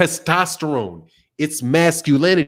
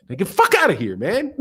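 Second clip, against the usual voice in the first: ordinary delivery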